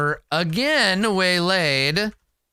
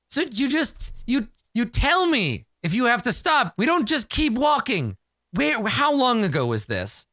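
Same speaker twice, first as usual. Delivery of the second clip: exasperated scolding; 8 semitones higher than usual. Acted. Character scolds the party.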